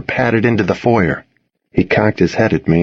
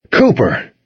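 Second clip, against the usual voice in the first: higher, falling one-word reproach; scolding; 4 semitones higher than usual. A narrator's reading. Boy to dog shaking off water, scolding. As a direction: mildly scold the dog by name. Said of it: "I scolded".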